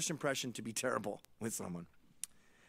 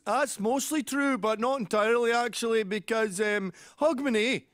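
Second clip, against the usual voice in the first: put-on high, loud Glaswegian accent; imitated Glaswegian; 9 semitones higher, 7 dB louder than usual. Glaswegian man weighing up dad's claim, in loud Glaswegian accent. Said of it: "I talked to a Glaswegian about that".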